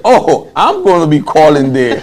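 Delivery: loud, high, thrilled exclamation; overjoyed, it's happening; excited. Retold or imitated. Telling his roommate the news, loud and thrilled.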